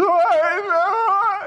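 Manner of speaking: high, loud laugh; loud squealing laugh; burst of laughter. Real, not acted. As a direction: crack up at your own image.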